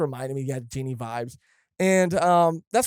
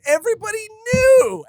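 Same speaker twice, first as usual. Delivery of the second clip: shrill jump way higher and louder on "knew"; outraged squeal; 7 dB louder than usual. Real, not acted. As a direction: squeal it in disbelief. Outraged exclamation.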